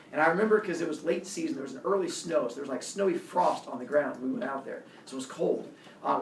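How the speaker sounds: usual speaking voice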